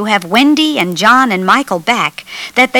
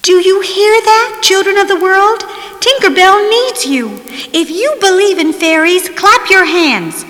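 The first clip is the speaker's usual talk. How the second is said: high-pitched plea building to loud shouts; urgent rallying call; 9 semitones higher, 3 dB louder than usual. A narrator's reading. Peter begs the world's children to clap for Tinker Bell, urgent high calling. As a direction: call out to a whole crowd, urging them louder.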